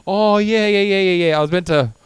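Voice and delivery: like remembering too late, mock sheepish; imitating the captain's belated realisation; sudden realisation. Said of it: "He goes".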